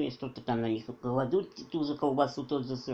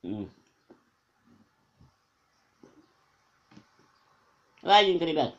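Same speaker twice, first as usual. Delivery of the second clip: talking while chewing; mouth full; 4 dB louder than usual. Real, not acted. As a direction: food in cheeks. Seen 3: Cheeks bulge, lips closed early on after sandwich bite; clearer later.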